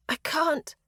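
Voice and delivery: words squeezed out through pain; choked out. A narrator's reading. Injured Aoife pleading with Ishka, choking. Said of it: "I choked out".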